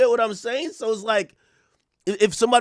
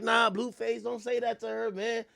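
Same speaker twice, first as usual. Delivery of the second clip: mad nice; 7 semitones higher than usual. Retold or imitated. Rappers timidly asking [name] to stop, mad nice. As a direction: meekly imitate rappers pleading, pitched up. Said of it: "They're mad nice".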